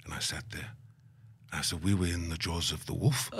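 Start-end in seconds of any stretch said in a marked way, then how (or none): 1.8-3.3 low, soft questioning repeat, end lifting; hushed query with a laugh near it; incredulous echo. Retold or imitated. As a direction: repeat it back quietly in amused disbelief. Incredulous echo of elder's words.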